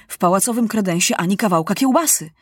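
ordinary delivery

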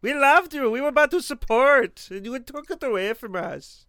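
high, loud mock-outraged complainer in a put-on voice; offended supporter. Imagined supporter berates the podcasters for the accent, in mock outrage. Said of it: "we're gonna get somebody, like, that supports us".

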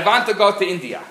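put-on high, loud, fast old lady demand; insistent old lady. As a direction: push it like a stubborn grandma. Old lady demanding at travel agency.

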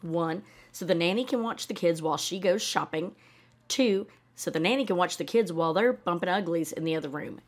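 ordinary delivery

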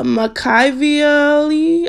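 "Makaveli" sounded out slowly with a high questioning lift; unsure pronunciation. Real, not acted. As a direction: stretch out "Makaveli", rising as if guessing. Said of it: "I don't know how to pronounce it".

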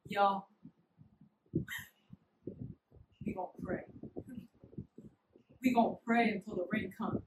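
low, very soft steady voice; calm. Retold or imitated. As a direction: keep it low and unbothered. Daniel calmly tells friends.

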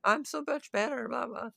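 high mock-weepy villain voice; mock crying villain. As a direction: whine it like a sore loser, hammed-up. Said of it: "like, crying".

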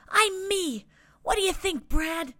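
proud little guy. A narrator's reading. Little guy shows off new look.

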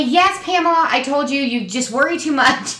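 high scolding mock mom; imitating mom. Daughter voicing mom calling her a worrier. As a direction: chide her like a child.

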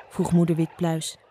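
usual speaking voice

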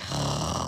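eye-rolling groan of a sigh; exasperated sigh. Retold or imitated. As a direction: breathe out in weary exasperation. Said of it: "I, like, roll my eyes. I'm like".

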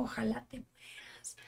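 slow breathy whisper, quoting the hater; whispered menace. Retold or imitated. Reading a troll's hateful comment aloud, slow whisper. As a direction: hiss it slowly like a spiteful comment.

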